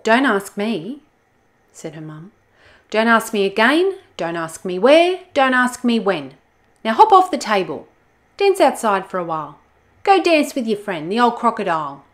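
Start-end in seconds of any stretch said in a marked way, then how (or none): 0.0-1.0 louder, higher scolding; exasperated mum. Acted. Mum fed up with Olga, loud scolding. 2.9-12.1 louder, higher scolding; exasperated mum. Acted. Mum fed up with Olga, loud scolding.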